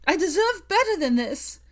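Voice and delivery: high, loud scream breaking into a sob. A narrator's reading. Hermione screaming her worth at Draco, sobbing. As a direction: scream it out through tears. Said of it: "she screamed, breaking off with a harsh sob".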